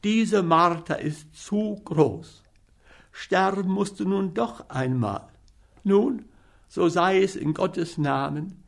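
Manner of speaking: put-on storybook boy voice in despair; despairing Hans. Hans despairs before poisoning.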